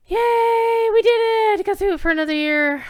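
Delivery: high, loud cheer of relief. Real, not acted. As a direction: celebrate finishing the reading out loud. Celebrates finishing the yearly reading.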